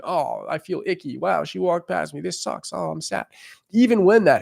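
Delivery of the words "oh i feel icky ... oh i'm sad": softer, lightly whiny self-pity; half-joking pout after rejection; mock sad. Coach mocking his own hurt feelings after rejection, mild mock sad.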